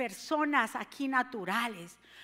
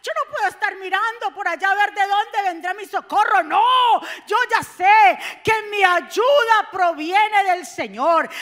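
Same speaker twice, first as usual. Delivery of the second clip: higher, loud realization, slowing to a shout on the last line; a hint of the psalmist crying out; psalmist realizing; 6 semitones higher, 10 dB louder than usual. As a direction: voice the psalmist's dawning faith. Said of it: "Él dice".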